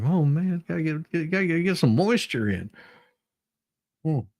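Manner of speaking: ordinary talk, nothing marked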